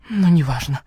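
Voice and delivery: plain reading voice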